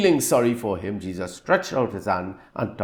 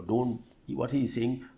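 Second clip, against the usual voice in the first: ordinary talk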